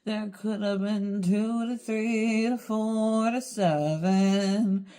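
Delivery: very slow, drawn-out poetic recitation over music; slow, wistful spoken-word reading; slow spoken-word poem. Acted. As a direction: recite it like a mournful poem, stretching each phrase.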